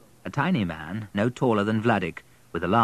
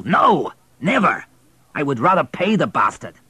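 raised, higher, urgent man's voice; louder, pressing the wife to get rid of him; a husband insisting in a raised voice; Pyotr, urgent husband; 5 semitones higher, 5 dB louder than usual. Acted.

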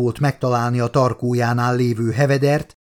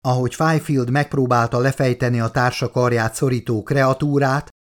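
ordinary delivery

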